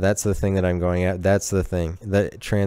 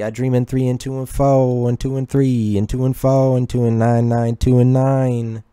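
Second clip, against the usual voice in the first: Speakeasy Tom; 3 semitones higher than usual. Pretend. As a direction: chant numbers and nonsense with mounting frenzy. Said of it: "Do you dream in numbers".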